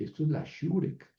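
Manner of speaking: ordinary talk, nothing marked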